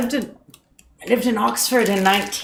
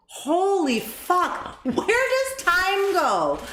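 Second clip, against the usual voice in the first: high, drawn-out wail of disbelief; dismayed disbelief; 9 semitones higher, slower than usual. Real, not acted. Host realizing how long ago it was, aghast. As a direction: stretch it out high, aghast at time passing. Shocked at twenty years passing.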